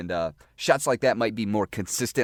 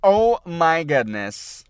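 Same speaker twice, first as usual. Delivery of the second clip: drawn-out, louder, higher groan of disbelief; frustrated exclamation; 8 semitones higher, 9 dB louder, much slower than usual. Real, not acted. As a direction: stretch it out like you just blew the shot. Missed shot during demo.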